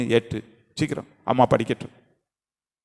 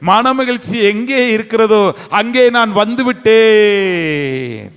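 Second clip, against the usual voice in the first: higher, slow, drawn-out sermon cry; impassioned preaching; 8 semitones higher, 5 dB louder, slower than usual. Acted. Preacher proclaiming Hannah's surrender, impassioned. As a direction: lift each word to the hall.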